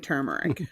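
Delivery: "turmeric" with the first R sounded, TUR-mer-ick; R pronounced. Shown as a demonstration. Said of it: "But the R is pronounced".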